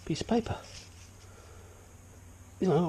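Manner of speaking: usual speaking voice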